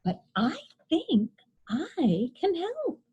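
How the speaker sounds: a touch of sweet helpfulness; old woman offering help brightly. A narrator's reading. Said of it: "she said brightly".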